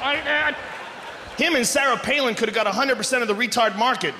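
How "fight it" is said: higher, coaxing chant; mock urging. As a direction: egg him on, rising. Urging Santorum to fight.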